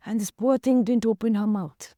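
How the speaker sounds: ordinary talk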